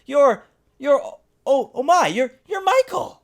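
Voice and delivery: put-on mother suddenly recognizing her boy; shocked mother. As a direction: stammer in dawning shock.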